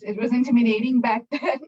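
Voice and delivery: real laugh breaking in near the end. Real, not acted. Panelist looking back on an intimidating moment, laughing. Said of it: "but I laugh now thinking about it".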